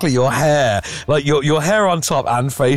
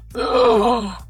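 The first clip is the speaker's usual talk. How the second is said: a high, hammed-up shocked gasp; theatrical gasp of mock shock; 12 semitones higher than usual. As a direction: gasp in fake horror at the twist. Reacting to the jilting.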